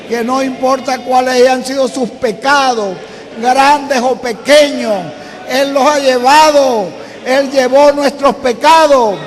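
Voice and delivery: loud, ringing pulpit declaration; fervent preaching. Acted. Preacher proclaims forgiveness and healing to the congregation, loud fervent preaching. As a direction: preach it out with growing fire. Preacher rising to full force.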